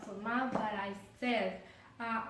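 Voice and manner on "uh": usual speaking voice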